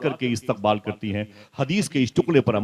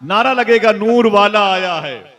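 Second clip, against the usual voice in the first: loud, high, drawn-out cry; rallying slogan; 8 semitones higher, 9 dB louder, slower than usual. Real, not acted. Preacher starts a slogan for the congregation, rallying cry. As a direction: lead the crowd in a ringing chant.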